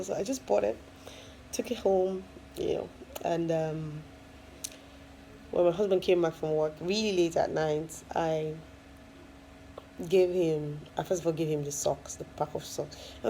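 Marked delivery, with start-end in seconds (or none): none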